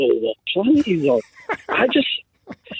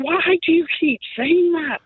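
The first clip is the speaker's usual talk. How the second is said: high-pitched exasperated question; exasperated dad; 12 semitones higher than usual. Retold or imitated. Father questioning his son's slang, mock exasperation. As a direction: pitch it right up, baffled. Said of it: "I'm like".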